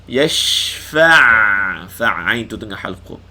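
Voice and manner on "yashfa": louder chanted yashfa', shin breathed out long; Quran recitation. Shown as a demonstration. Teacher showing students the breathy shin, recitation. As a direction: recite it letting the air of the shin flow. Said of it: "Panjangkan bunyi shin. Hamburkan angin dia".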